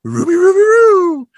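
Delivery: very high, loud cartoon dog howl; Scooby-Doo impression. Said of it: "Scooby looks at the camera".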